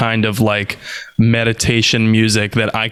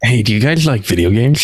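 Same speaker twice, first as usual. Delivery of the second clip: video game quote. Retold or imitated. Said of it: "Do you guys happen to be Mass Effect fans?".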